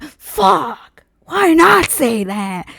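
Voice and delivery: high, slow, drawn out frustration; frustrated regret. Retold or imitated. Kicking herself for not saying it, drawn out. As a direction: groan it in regret. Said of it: "I'm like".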